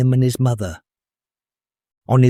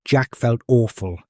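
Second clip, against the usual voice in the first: usual speaking voice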